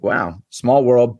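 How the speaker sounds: slow, unimpressed "wow"; mildly surprised. Retold or imitated. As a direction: say it drawn out and mock-casual. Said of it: "not as shocked as you would be".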